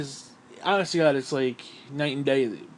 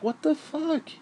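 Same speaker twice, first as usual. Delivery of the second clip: high, incredulous what the fuck; stunned gamer disbelief; baffled disbelief; 11 semitones higher, 4 dB quieter than usual. Retold or imitated. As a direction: blurt it out in shock, pitched way up. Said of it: "and I'm like".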